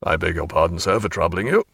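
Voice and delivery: low, civil murmur. Acted. Highwayman murmurs to Stephen, low and civil. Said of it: "the civilest murmur in the world".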